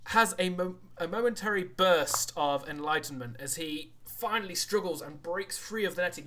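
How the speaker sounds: ordinary talk, nothing marked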